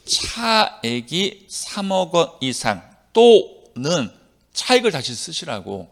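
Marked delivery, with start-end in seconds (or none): 0.1-4.1 very slow "차액이", "삼억 원 이상", each chunk separated; deliberate interpretive reading. Shown as a demonstration. Teacher showing students how to read for meaning, very slow and phrased. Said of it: "조문을 해석해서 읽자고요".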